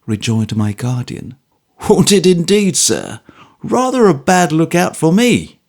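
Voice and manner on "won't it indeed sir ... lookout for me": cheerful, wry retort, higher and louder; good-humoured. A narrator's reading. Trooper cheerfully brushing off the guardian's warning. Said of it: "Mister George good-humouredly observed".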